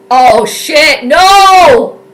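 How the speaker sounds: loud high cry of disbelief; anguished no; dismayed shout. Real, not acted. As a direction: yell at the screen as it goes wrong. Reaction to bad play.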